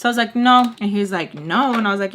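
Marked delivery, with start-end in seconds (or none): 0.4-0.7 loud, higher-pitched retold no; firm refusal. Refusing his version in the argument, loud and firm. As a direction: shoot it back louder and higher.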